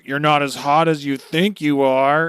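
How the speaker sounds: loud sneering villain taunt. Retold or imitated. Recapping, voicing villain mocking Luffy. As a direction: jeer at him, loud. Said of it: "Dunkachino's like".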